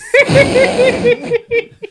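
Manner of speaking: loud, very high cackling laugh; helpless laughter. Real, not acted. Friend cracking up at the group's riffing, helpless high laughter.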